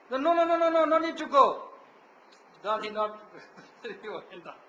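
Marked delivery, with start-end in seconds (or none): 0.1-1.5 loud, high string of hurried refusals; retold objection, voice jumping up; urgent protest. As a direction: imitate an elder calling someone back. Gurudev stops disciple from leaving.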